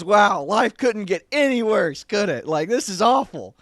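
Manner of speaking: a sparrow griping about its awful luck; sarcastic sparrow. Acted. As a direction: moan it as a miserable little bird covered in muck. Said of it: "And he goes".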